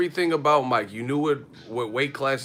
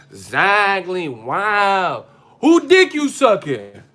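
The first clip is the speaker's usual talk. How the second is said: higher, louder, slow yelling woman; shrill mock neighbor; 6 semitones higher, 9 dB louder, much slower than usual. Angry girlfriend yelling at her man in the hotel, slow high mock yell. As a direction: climb up into a drawn-out screeching accusation.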